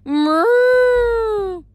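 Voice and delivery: high, long drawn-out moo; cow moo. Acted. The cow serpent mooing to correct its name. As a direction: moo like a calf calling. Bessie moos again.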